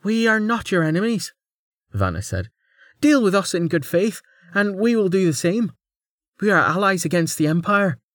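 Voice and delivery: captain urging the bird-lord to be allies; earnest young captain. A narrator's reading. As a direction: offer peace in good faith. Vanna voiced by narrator.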